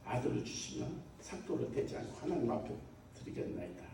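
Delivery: imitating a humble murmured prayer; hushed prayer vow. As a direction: quote the vow very softly, voice dropped. Voicing Hannah's prayer of vow.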